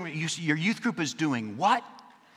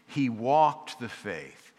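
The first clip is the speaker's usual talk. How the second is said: slow emphasis; slower than usual. Acted. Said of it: "repeated phrase, slowed".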